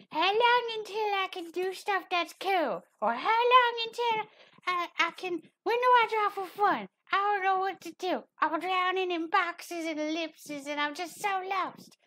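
high, whining mock student; whiny student voice. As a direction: squeak it out like a lost beginner. Mocking whiny student questions.

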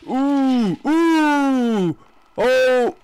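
high excited cheering. Real, not acted. Escaping, high excited cries.

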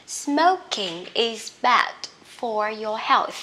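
word-by-word teacher dictation; slow model reading. Shown as a demonstration. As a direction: read it out very slowly for learners. Teacher models example sentence slowly. (đọc mẫu thật chậm, rõ từng từ)

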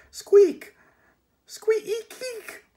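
very high, loud squeaking; piercing rodent squeals; rat squeaks. Acted. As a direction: squeak and eek like a frantic rat. Death of Rats squeaks.

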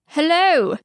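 high, called-out British hello; forced British accent. A narrator's reading. Prince calls up to the tower window, forced British accent. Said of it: "An obviously forced British accent calls from below".

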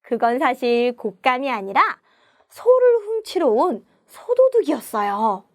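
usual speaking voice

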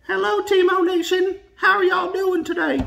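very high, squeaky stuffed-animal voice; koala voice. Pretend. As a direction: speak for the toy koala, cute and tiny. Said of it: "I talk for him".

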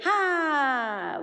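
put-on long, loud, stretched-out હા, like children answering; loud drawn-out yes. Shown as a demonstration. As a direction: stretch the હા out and call it loudly. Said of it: "મોટેથી બોલ્યા ભાઈ". (મોટેથી લાંબું ખેંચેલું હા)

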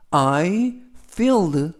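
I filled, very slow, spaced out; careful English reading. Shown as a demonstration. Teacher reading the example sentence slowly. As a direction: read I filled slowly for learners. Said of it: "이때 이디를 빼고 fill 하면".

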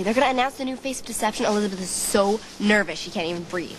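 normal talking voice

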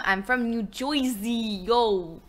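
broad put-on "New Joisey" accent; playful Jersey wise-guy accent. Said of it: "where my southern accent comes from".

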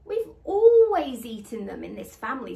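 大声、严厉的父亲，语气不容置疑; 板着脸，重重地把话压下去; stern father. Acted. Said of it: "said Papa sternly".

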